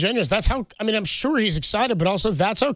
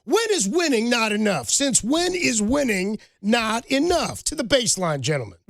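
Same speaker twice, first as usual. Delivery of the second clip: slow, higher stern coach lecture; Coach Carter impression; 5 semitones higher, slower than usual. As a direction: drag it out like a disappointed coach. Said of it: "he goes".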